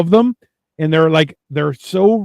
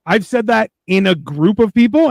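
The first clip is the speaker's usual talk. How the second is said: usual speaking voice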